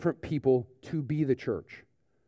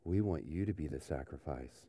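low, much softer creepy cult voice; eerie, murmured cult invitation, pitched low; 10 semitones lower, 13 dB quieter than usual. Retold or imitated. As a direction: hush it like a cult luring in a new recruit. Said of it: "in that creepy, like, cult-like way".

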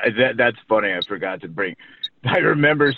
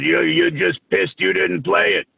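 higher-pitched imitated retort; mock accusing jab, raised in pitch; retold accusation; 8 semitones higher than usual. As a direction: quote a friend's needling comeback, pitched up. Retold teasing retort between two friends.

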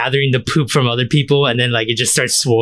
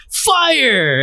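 shouted command; 14 semitones higher than usual. Pretend. Commanding a firing squad.

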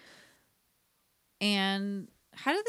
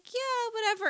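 high voice; 12 semitones higher than usual. Retold or imitated. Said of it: "and then she's like".

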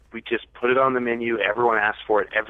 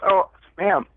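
high, louder show of helpfulness; retold calling out; 5 semitones higher, 4 dB louder than usual. Stopping her before she left, put-on helpful call. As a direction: call sweetly after someone, pitched up high.

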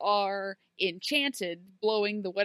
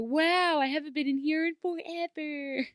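high-pitched mock wonder; gushing, hammed-up marvelling; mock amazement; 7 semitones higher than usual. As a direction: imitate exaggerated awe in a raised voice. Mimics characters marvelling at place.

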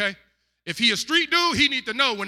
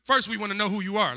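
usual speaking voice

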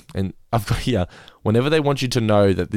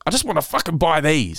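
higher, exasperated mock complaint; angry retold; 7 semitones higher than usual. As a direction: imitate an irate customer at the till. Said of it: "like, angry".

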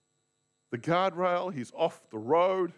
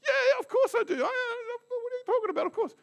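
very high, squeaky, louder protest; exasperated Peter; 5 dB louder, faster than usual. Acted. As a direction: squeal it out, baffled he's asked again. Peter asked a second time, indignant.

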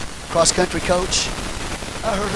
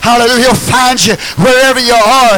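ordinary talk

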